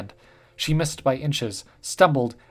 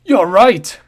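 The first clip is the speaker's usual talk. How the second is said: loud, higher booming shout; 8 semitones higher, 15 dB louder than usual. A narrator's reading. The officer booming at Celeste in anger. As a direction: bellow it back at her. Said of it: "boomed the officer".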